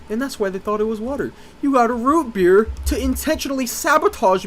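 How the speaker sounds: high, loud accusing mom voice, rising as she goes; interrogating mom. Retold or imitated. Friend's mom grilling the boy over the root beer, high and accusing. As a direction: stammer then build into a shrill interrogation. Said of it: "She's just interrogating me like".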